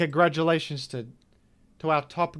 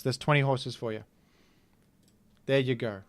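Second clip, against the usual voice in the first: ordinary delivery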